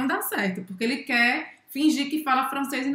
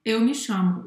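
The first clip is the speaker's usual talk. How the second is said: ordinary talk, nothing marked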